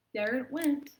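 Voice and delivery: hammed-up reading of a sniffly poem; milk every sneeze for the kids; dramatic poem reading. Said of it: "lends itself to a pretty dramatic reading".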